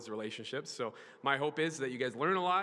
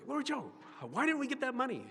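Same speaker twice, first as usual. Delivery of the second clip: high questioning voice; 10 semitones higher than usual. Retold or imitated. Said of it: "I'm like".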